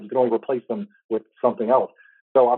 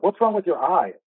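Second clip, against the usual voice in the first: high, mocking schoolgirl question; a touch of the new girl's sneer, pitched up; 7 semitones higher than usual. New girl asking Emma at lunch, high mocking voice.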